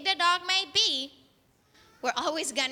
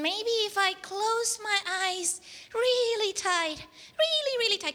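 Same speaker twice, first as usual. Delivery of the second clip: acted scared boy; 6 semitones higher than usual. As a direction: play a frightened child squeezing eyes shut. Scared boy shuts his eyes.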